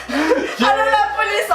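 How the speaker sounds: high, drawn-out mock call for help; mock alarm. Joking about phoning the police on her date, mock alarm. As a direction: play up the alarm, half laughing.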